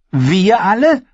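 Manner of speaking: put-on high, bold young wildling woman; spirited young woman, teasing; Ygritte voice. Ygritte retorts.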